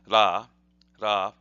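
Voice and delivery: ra with a tapped r, between D and R; Japanese r in ra. Shown as a demonstration. Teacher explaining the R sound in Japanese, tapped ra. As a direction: tap the r of ra like d plus r. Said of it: "R sound in Japanese is almost like a D plus an R".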